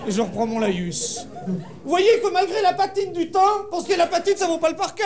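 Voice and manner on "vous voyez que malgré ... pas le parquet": high, projected lecture voice; guide's declaiming. Acted. Guide resuming his spiel for inattentive tourists, high declaiming. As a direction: recite the spiel high over the group.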